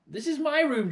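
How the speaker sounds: put-on high, loud boy's protest; indignant shout. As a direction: yell it at the mess. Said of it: "George shouted".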